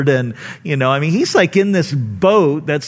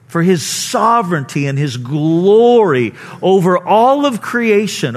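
ordinary delivery

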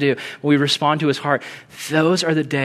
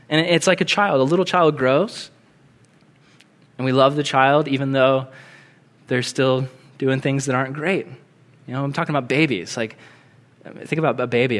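ordinary talk

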